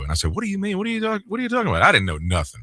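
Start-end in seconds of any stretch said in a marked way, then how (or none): 0.3-1.8 high, soft, hurried mock confusion; baffled. Young host answering his manager, acted bafflement. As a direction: play the clueless young employee, pitched right up.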